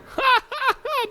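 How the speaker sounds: high cackle at own pun; high-pitched laugh. Real, not acted.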